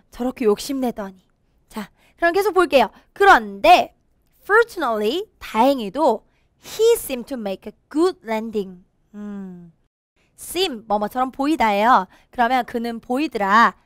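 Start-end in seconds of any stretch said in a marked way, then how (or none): none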